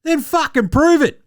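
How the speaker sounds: high, loud "prove it" challenge; exasperated shout. Retold or imitated. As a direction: yell it at the shooter, fed up. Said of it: "And you're like".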